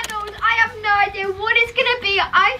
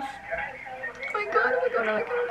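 hushed; 10 dB quieter than usual. Real, not acted. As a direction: keep it down so the caller can't hear. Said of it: "I'm holding it so they can't hear me".

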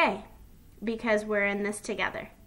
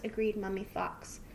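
ordinary delivery

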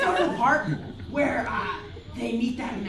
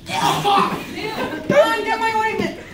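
squealed high and loud through laughter; high laughing shout; 12 semitones higher, 8 dB louder, much slower than usual. Acted. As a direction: shriek it out while cracking up. Dubbing a character, laughing.

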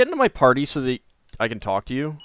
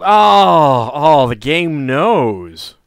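awed; 3 semitones higher, 11 dB louder, much slower than usual. Real, not acted. Awed at the game.

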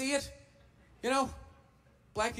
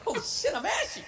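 mock shocked realisation; 7 semitones higher than usual. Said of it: "I'm like".

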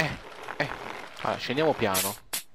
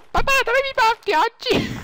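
put-on very high kid voice; whiny child voice; 3 dB louder than usual. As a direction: act a whining little kid.

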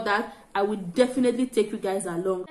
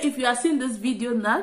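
ordinary talk

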